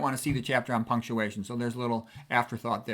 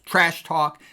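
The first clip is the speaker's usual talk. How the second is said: TRASH TALK, both beats punched louder; both syllables stressed; 3 semitones higher, 5 dB louder than usual. Shown as a demonstration. Teacher showing how macho it sounds, Trash talk with double stress. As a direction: land hard on Trash and on talk. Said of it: "They're both stressed syllables".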